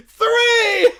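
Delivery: high loud yell of the number; excited shout. Real, not acted. Host shouting the winning number. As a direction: blurt it out triumphantly.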